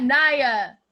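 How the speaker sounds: usual speaking voice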